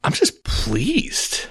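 a slow, high, savored sigh of pleasure; deeply pleased. Retold or imitated. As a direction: draw out pleased, lifted up in delight. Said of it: "God looks at and goes".

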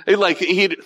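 usual speaking voice

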